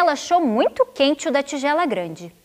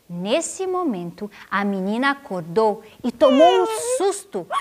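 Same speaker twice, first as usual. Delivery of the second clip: usual speaking voice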